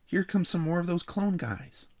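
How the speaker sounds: put-on high-pitched, loud kid voice; loud announcement. A narrator's reading. Jeffrey announcing the clone guys' arrival, loud and high. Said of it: "announced Jeffrey loudly".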